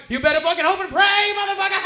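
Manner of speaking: high, booming taunt; Ralphie. Retold or imitated. Headliner taunts the panicking comic, loud high yell. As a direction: imitate a full-volume jeering shout. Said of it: "He's like".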